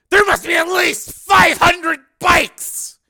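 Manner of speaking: high, slow, loud astonished cry; amazed shout. A narrator's reading. Hardesty shouting in amazement at the huge biker column. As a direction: bellow it in disbelief.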